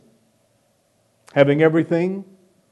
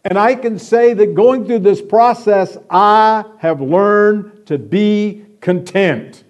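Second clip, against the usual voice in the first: louder, higher preaching, slowing down on the last words; fervent preaching; 6 semitones higher, 6 dB louder than usual. Acted. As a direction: testify with conviction, stretching out "content".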